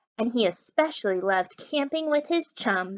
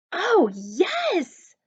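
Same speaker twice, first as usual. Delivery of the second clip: eager yes; 8 semitones higher, 5 dB louder than usual. A narrator's reading. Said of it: "said Mitzi and Murphy".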